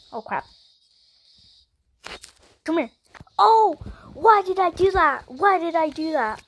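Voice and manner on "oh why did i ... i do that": dismayed outburst. Real, not acted. Blunder in game.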